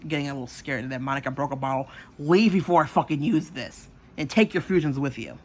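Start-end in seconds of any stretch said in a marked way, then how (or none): 2.2-5.4 loud acted ultimatum; re-enacted furious warning; angry threat. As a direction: shout them out of the house. Acted threat with broken bottle.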